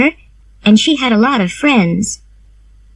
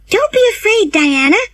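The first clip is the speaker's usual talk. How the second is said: Anne's voice; 12 semitones higher than usual. A narrator's reading. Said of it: "said Anne".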